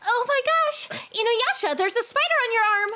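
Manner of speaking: high, loud panicked cry; panicked alarm. Acted. Girl spots a spider on Inuyasha, high panicked cry. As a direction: shriek it out in alarm.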